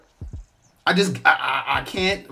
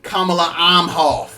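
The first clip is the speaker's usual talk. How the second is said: slow, pointed "Kamala Imhoff", louder and higher; mocking new name; 5 semitones higher, 5 dB louder, slower than usual. Real, not acted. Renaming Harris to the audience, slow mock emphasis. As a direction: lean hard on "Imhoff" with scorn. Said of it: "stop calling her Kamala Harris".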